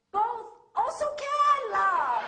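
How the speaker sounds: loud, high local-English flourish. Pretend. Speaker championing local English to audience, playful local accent. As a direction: belt out the local catchphrase. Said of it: "So I say".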